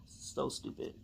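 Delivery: disgusted, voiceless mutter; frustrated whisper. Real, not acted. To herself about the wrong needle, in a frustrated whisper. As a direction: mutter it under the breath.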